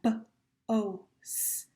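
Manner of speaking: B-O-S-T as separate sounds, b, oh, sss, t; sounded out. Shown as a demonstration. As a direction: break B-O-S-T into slow single phonemes with gaps. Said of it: "if we take out that".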